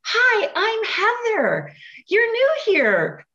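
drawn-out high peppy greeting; cheerleader Heather. Retold or imitated. Cheer captain greets new girl, high and slow. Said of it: "she came up to me and she says".

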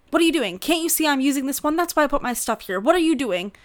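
retelling a woman's rude scolding at the beach shower; mock angry yelling. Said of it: "she starts yelling at me".

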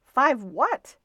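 baffled at the tiny order; puzzled attendant. A narrator's reading.

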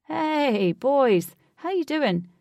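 high, loud friendly hello; familiar greeting. A narrator's reading. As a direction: greet old friends warmly. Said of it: "with immense familiarity".

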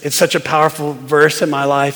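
usual speaking voice

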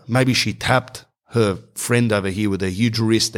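usual speaking voice

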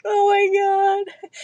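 high, slow, drawn-out gasp of disbelief; mock-stunned reader reaction; stunned oh my god. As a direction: let it stretch out high, reliving the shock. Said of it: "I was like".

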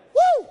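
high, loud shout of joy; preacher's triumphant whoop; ecstatic woo. Real, not acted. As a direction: let out a joyful whoop. Ecstatic cry after the climax.

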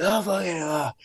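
high, breath-heavy panic without volume; freaking out. Acted. Contestant just woken up, acting out freaking out in a high voice. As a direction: act out a gasping freak-out. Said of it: "So I'm freaking out".

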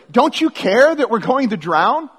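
high, loud, accusing question; panicked plea. Acted. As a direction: cry out in acted desperation. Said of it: "they say it this way".

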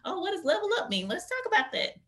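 high, bright teasing explainer voice; mock presenter. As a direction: playfully quote herself in a meeting. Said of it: "I'm like".

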